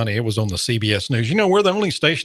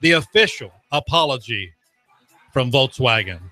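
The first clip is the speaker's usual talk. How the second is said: loud, slow, emphatic station plug; radio announcer; 4 semitones higher, 4 dB louder, slower than usual. Real, not acted. Host plugging the station after a spoof apology, announcer style. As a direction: raise pitch and volume like a proud announcer.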